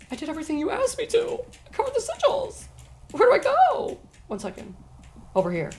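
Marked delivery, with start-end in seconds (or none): 0.1-4.0 high-pitched, soft and quick pleading; high plea. Real, not acted. Player pleading with the game for directions, high plea.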